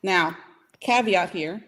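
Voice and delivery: ordinary talk, nothing marked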